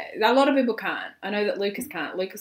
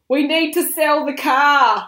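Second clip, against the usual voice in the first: slow, loud, higher mock proclamation; grand announcement; 7 semitones higher, 10 dB louder, slower than usual.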